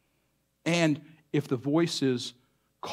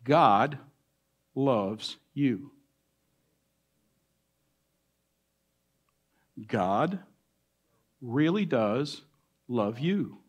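tender assurance; 2 semitones lower, much slower than usual. Real, not acted. Slow tender assurance to congregation.

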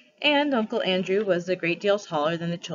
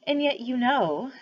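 slow musing elephant; 6 semitones higher, 3 dB louder, slower than usual. A narrator's reading.

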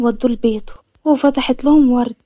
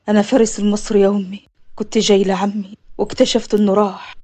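low-pitched man's voice, subdued; low, sober introduction; composed returning nephew; 6 semitones lower than usual. Acted. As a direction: play the man deep and steady.

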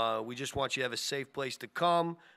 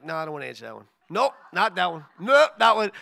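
dismissive rejection; 3 semitones higher, 12 dB louder than usual. Retold or imitated. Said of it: "I'm like".

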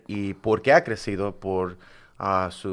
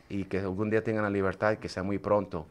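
ordinary talk

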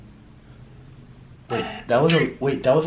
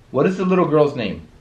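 higher, louder questioning call; 5 semitones higher, 6 dB louder than usual. Real, not acted. Investigator asking the little girl's name, calling out. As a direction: send the question out to the room.

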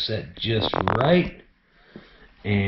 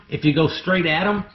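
ordinary talk

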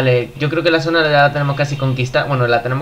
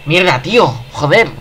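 furious gamer shout; raging gamer; 3 semitones higher, 4 dB louder than usual. Real, not acted. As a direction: yell it out in real rage. Shouts after being killed.

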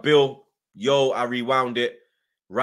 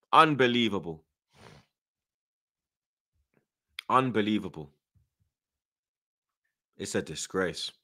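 sinking low and quieter; disgusted disbelief; 6 semitones lower, 4 dB quieter than usual. Real, not acted. Fan disgusted by the extra time, dejected disbelief. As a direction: let the outrage drain into dejection.

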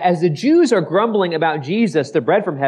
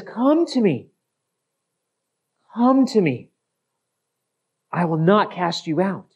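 gentle invitation. Acted.

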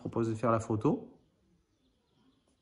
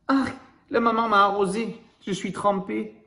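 put-on higher, childlike voice, playfully dismayed; soaked little animal; 6 semitones higher than usual. A drenched young animal complains to the listener, in a high playful voice. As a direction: squeal it like a drenched little one.

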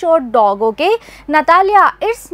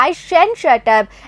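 ordinary talk, nothing marked

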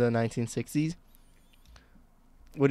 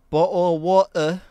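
loud, higher hammed-up British accent; mock British accent; 5 semitones higher, 7 dB louder than usual. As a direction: drop the t's like a mock Brit. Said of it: "what is a modern British accent".

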